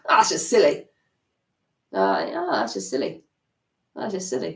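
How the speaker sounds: laughing it off as silly; chuckle, this is silly; light laughing dismissal; amused dismissal. Shown as a demonstration. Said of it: "you want your inner voice to go".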